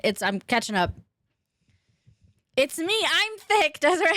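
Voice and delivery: normal talking voice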